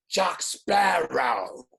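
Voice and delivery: loud, drawn-out growled Irish pirate call; shouted mock Irish accent. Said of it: "I just gave him an Irish accent".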